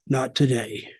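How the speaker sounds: dropped low and quiet, a menacing hush; low quiet Undertaker menace. Retold or imitated. Undertaker delivering his line to Bron Breakker, low and quiet. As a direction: let the line fall low and quiet like a threat. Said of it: "He said".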